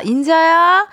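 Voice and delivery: usual speaking voice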